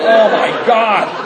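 slow, laughing mock dismay; drawn-out laughing disbelief at eight guys; stunned laugh. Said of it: "I'm like".

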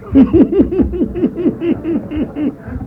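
long, high staccato cackle; repeated high-pitched hee-hee; imitated laugh. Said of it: "he got up and he went".

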